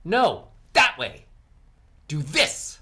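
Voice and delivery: THAT and THIS punched short and loud; short emphatic words. Shown as a demonstration. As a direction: hit THAT and THIS hard, with a break before each. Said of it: "Or I make it shorter".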